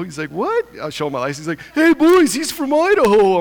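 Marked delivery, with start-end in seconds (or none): 0.3-0.7 high-pitched, incredulous "what?"; mock astonishment, voice leaping up; baffled cop. Officer baffled by Idaho, acted out. 1.7-3.4 mock cop hollering to his buddies; loud, high, hammed-up announcement; calling out. Officer calls out to his colleagues.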